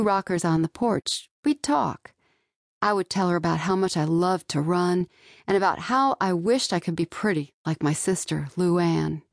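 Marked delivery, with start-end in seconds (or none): none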